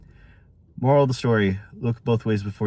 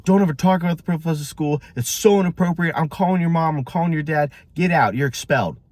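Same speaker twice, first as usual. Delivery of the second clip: louder, higher-pitched angry telling-off; acted angry outburst, raised voice; mad principal; 7 semitones higher, 3 dB louder than usual. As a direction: imitate a principal losing his temper. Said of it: "he gets really mad".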